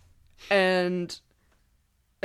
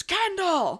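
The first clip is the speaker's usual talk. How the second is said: high called-out name; retold shout, pitched high; mock scream of a name; 11 semitones higher than usual. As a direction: call the name out high across the trail.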